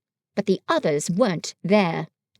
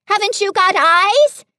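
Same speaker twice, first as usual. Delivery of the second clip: higher, louder frantic shout; 10 semitones higher, 7 dB louder than usual. A narrator's reading. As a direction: yell in exasperation. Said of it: "shouted Piglet".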